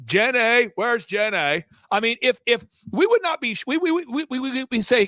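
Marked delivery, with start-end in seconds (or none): 0.1-1.6 plaintive call in a movie-character voice; Forrest Gump. Acted. Host imitates the film hero looking for Jenny, Forrest Gump impression. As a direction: call out in a slow Southern impression. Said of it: "if he didn't talk like this".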